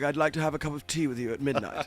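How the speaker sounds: mock posh Cumberbatch-style English; posh English accent. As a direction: play the upper-class gent at tea, lightly. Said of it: "very posh".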